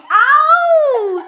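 long, loud, high-pitched "ow"; mock pain yelp. Storyteller re-enacting the electric fence shock, mock pain yelp. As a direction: yelp as if zapped, hammed up for laughs.

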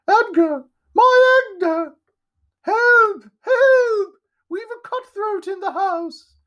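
very high, loud shriek of alarm; shrill scream. A narrator's reading. Well-dressed lady finds Edgar bleeding, screaming shrilly. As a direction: scream for help in a piercing falsetto. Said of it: "interrupted by a shrill scream".

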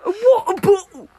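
high British parody with swallowed consonants; exaggerated British accent. Friend echoing the water bottle joke, exaggerated British accent. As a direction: overdo the British accent till words blur. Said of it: "British people be like".

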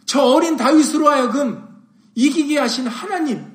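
impassioned preacher in full flow; forceful preaching. Real, not acted. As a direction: drive each phrase home to the congregation.

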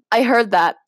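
ordinary delivery

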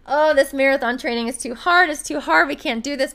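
hammed-up "too hard" grumble; mock complaint. Acts out the excuse they could make.

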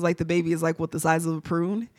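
ordinary delivery

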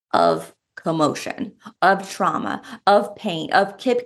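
ordinary talk, nothing marked

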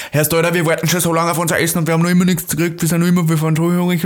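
high, loud angry granny in thick Austrian dialect; Austrian dialect shouting. Retold or imitated. Retelling grandma yelling at the waiter in dialect. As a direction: yell like a starving grandma in dialect. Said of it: "hat auf Dialekt so richtig geschrien".

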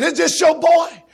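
loud, slow preacher's question; shouted question. Acted. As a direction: call it out to the parents.

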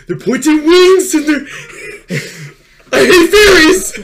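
high, loud frantic yell; fairy rant. Pretend. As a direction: rant at the fairies in a laughing frenzy. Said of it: "Go on a rant about fairies".

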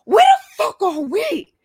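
shrill panicked demand; mock panicked yell from a confused friend; high yelling quote. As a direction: pitch up and shout it confused.

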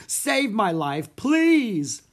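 beg, stretching out the last word; desperate plea. A narrator's reading. Theater manager begs to be rescued, pleading desperately.

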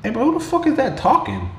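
imitating Ultron calling out the narrator; Ultron's retort. Narrator retelling Ultron catching the Watcher, mock-indignant high voice. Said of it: "And Ultron's like".

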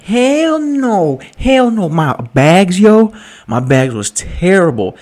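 high, loud hell no, then dragging out the bags; hammed-up refusal to take pictures; mock outburst. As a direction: squeal the refusal, then slow down on the complaint. Retells refusing the photos.

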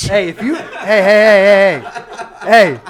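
loud, repeated heys, then a warning; mock scolding shout. Co-host threatens friend with a ban, mock shout. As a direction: cut in loudly with pretend outrage.

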